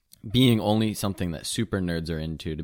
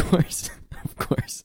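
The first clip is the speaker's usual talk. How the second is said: voiceless, through real laughter; laughed-out words, losing the voice; laughing agreement; 8 semitones higher, 3 dB quieter than usual. Real, not acted. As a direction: agree while cracking up. Amused agreement to the insult.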